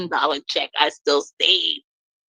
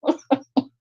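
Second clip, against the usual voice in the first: laughing; 9 semitones higher than usual. Real, not acted. Gloating about being happy now.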